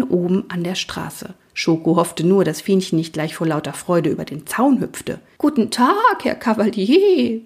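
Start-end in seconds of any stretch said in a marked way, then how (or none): 5.4-7.4 high, drawn-out, enraptured greeting; whinny it like an enchanted countess; delighted whinny. A narrator's reading. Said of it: "wieherte die Gräfin entzückt". (entzückt wiehern)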